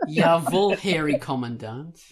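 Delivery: put-on German salute in a Minnesotan accent; loud Minnesotan lilt on the first word. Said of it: "You just sounded so Minnesotan".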